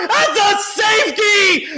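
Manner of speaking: excited shout. Real, not acted. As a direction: announce the safety at full voice. Calling the safety.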